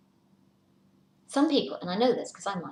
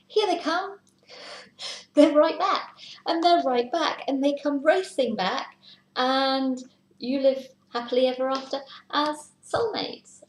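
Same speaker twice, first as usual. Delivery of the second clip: higher and louder, thrilled delivery; excited; 6 semitones higher, 6 dB louder than usual. Real, not acted. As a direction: announce the happy return with delight. Partner returns, happy ending.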